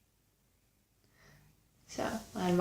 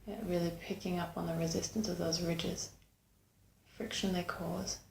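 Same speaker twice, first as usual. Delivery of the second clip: unhurried meditation guide, long pauses between phrases; calm meditation voice, growing softer towards the end; guided meditation; 7 dB quieter than usual. Acted. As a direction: guide them gently inward, leaving space after each phrase.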